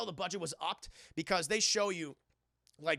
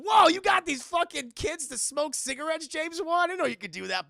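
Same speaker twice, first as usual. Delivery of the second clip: high, loud astonished yell; amazed shout; 9 semitones higher, 9 dB louder than usual. Retold or imitated. Viewer shocked at kids smoking, loud astonished yell. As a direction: blurt it out in shocked disbelief. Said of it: "I was like".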